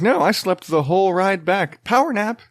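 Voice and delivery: put-on a touch of a proud little kid; toddler voice. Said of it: "He's like".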